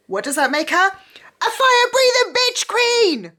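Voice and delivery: high, loud, gleeful squeal; excited shout. Real, not acted. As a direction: shriek it with fangirl delight. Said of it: "I'm getting excited now".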